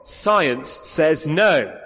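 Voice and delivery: slow mock refusal. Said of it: "hears the response".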